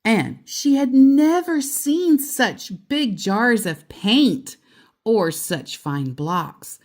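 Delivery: plain reading voice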